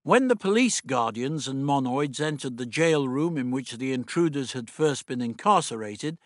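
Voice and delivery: ordinary delivery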